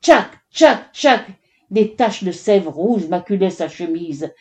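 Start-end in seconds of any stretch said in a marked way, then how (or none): 0.0-1.2 loud, high, percussive axe blows; chopping sounds. A narrator's reading. Narrator imitating the woodcutter's axe, forceful chops. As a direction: hit each one hard and separately. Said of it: "il cognait si fort".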